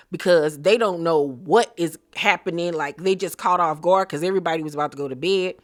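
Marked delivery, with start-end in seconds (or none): none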